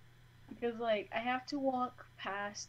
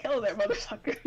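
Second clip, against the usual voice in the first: a lightly voiced tough wasp, louder greeting; 4 dB louder, faster than usual. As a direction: greet sweetly then swear like a thug wasp. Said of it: "It's like".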